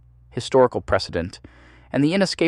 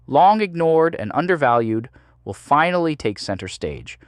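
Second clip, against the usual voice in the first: ordinary talk, nothing marked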